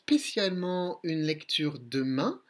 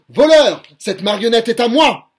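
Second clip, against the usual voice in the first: very loud, higher angry bellow; furious yell; 6 semitones higher, 16 dB louder than usual. Acted. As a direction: roar at the thief at full volume. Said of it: "Il hurle".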